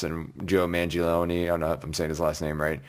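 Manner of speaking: normal talking voice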